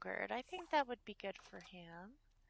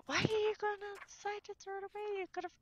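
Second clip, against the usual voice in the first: high, loud, fast mock complaint; voicing the Sim's fussy question, quick and high; acting out Sims character; 10 semitones higher, 5 dB louder, faster than usual. Player voices her Sim scolding another, high and loud. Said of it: "so he's going".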